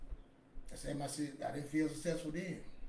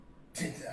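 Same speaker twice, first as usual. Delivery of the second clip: short disbelieving laugh; 3 semitones lower, 5 dB quieter than usual. Real, not acted. Laughs at the boss's remark.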